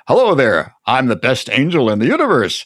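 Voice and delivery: mock-grand angel announcing himself; showy, self-important angelic greeting. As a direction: boom it out like a big, beautiful angel. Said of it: "big, beautiful ang-, angelic body and say".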